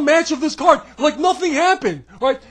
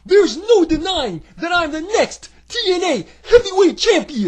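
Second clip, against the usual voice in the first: higher, boastful little puppet voice; 5 semitones higher than usual. Acted. Puppet bragging about the title, higher boastful voice. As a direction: brag like a tiny puppet.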